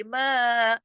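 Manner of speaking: मा drawn out for two beats; lengthened vowel. Shown as a demonstration. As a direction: hold the open vowel of मा steadily. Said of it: "दो हरकत खींचने के लिए".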